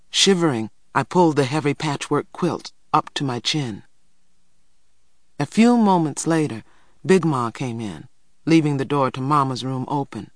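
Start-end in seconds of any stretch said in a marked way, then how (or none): none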